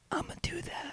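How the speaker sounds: whispered inner thought. Retold or imitated. Host voicing a player's secret thought on the bench, whispering. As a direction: say it under the breath, barely voiced. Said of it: "running through his head is".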